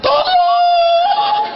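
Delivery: very high, stretched squeal; comic high-pitched cry of distress; mock scream for help. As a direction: shriek for help like squeezed thighs. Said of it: "sampai menjerit tuh paha".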